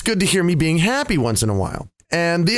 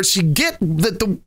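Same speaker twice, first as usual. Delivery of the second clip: ordinary talk, nothing marked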